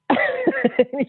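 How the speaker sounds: high burst of laughter; nervous laugh. Real, not acted. Telling a friend about the answer, high laugh. Laughs at her own story.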